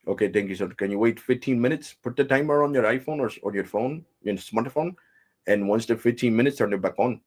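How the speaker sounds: put-on Indian tech-support accent; mimicked Indian English service patter; imitated Indian agent. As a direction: give instructions like an Indian phone agent. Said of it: "That's exactly how he talked".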